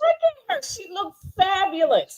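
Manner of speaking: over-the-top admiration; gushing. Retold or imitated. Gushing over her look.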